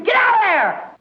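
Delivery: high-pitched urgent warning cry; high, urgent holler from the pulpit; screamed warning. Acted. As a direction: cry out to someone heading for danger. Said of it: "I'm screaming to you".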